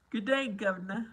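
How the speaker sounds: a light mock British accent, rising on day; imitating British accent. Streamer mimics chat's British accent bit, lightly done. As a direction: play it like a chirpy Londoner greeting. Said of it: "responds in a British accent".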